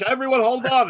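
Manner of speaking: high, loud call for order; imitated bossy shout. Retold guest calling out to meeting.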